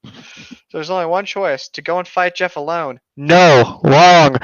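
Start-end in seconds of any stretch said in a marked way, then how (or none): 3.2-4.4 shouted no, wrong; loud no. Real, not acted. Friend shouting down the story's dumb choice, loud. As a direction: blast out the rejection.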